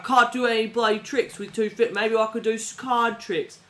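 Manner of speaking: mock posh English protest; English accent. Narrator mimics Bale refusing, English accent. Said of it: "'cause he's English".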